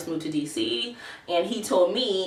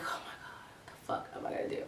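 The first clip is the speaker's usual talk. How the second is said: hushed whisper to oneself; barely voiced, privately panicking whisper; whispered dismay; 20 dB quieter, faster than usual. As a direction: whisper it in stranded disbelief. Stranded, muttering to herself in the story.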